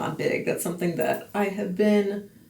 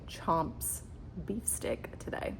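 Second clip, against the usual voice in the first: whispered aside; 9 dB quieter, slower than usual. Real, not acted. To viewers, admitting her only snack: whispered. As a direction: drop to a breathy whisper on the snack. Confessing how little she ate.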